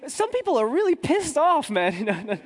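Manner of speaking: high, amused mock warning with a laugh; contractor's warning. Contractor warning the traveller about angry soldiers, amused and high. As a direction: play the contractor half-laughing as he warns. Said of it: "And he goes".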